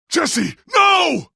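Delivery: high, loud cry of alarm; alarmed shout. Acted. Seeing Jesse in danger, an alarmed shout. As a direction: cry out to stop her.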